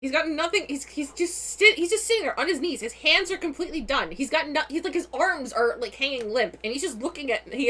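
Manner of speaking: ordinary talk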